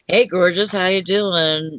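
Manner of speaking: ordinary talk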